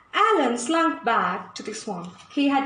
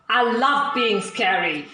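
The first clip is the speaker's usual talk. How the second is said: lower, slow gloating villain voice; laughing alligator; 5 semitones lower than usual. Acted. Alan the alligator boasting to the jungle, slow low gloat. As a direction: savour it like a proud scary alligator. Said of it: "laughed Alan".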